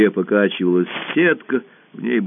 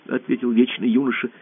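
usual speaking voice

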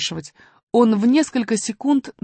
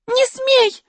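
put-on high loud girlish protest; shouting; 4 dB louder than usual. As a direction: shout at him to stop. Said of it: "крикнула Алиса". (возмущённый крик)

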